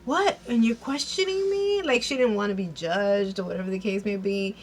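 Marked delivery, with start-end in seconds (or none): 0.0-1.8 high, offended mock comeback; indignant retort. Van snaps back at Candace, high indignant retort. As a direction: throw the question back, indignant. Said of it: "she's like".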